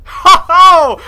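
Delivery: loud, high cheer; hyped battle whoop; battle cry. Retold or imitated. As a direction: shout it like charging in. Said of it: "I'm like".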